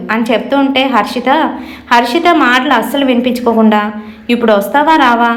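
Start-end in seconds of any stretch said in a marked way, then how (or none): 4.3-5.3 a touch of angry impatience; mildly angry. A narrator's reading. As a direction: demand an answer, mildly cross. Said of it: "కోపంగా మాట్లాడుతుంది". (కాస్త కోపంగా)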